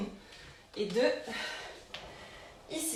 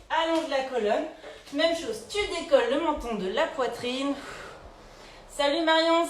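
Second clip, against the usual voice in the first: ordinary delivery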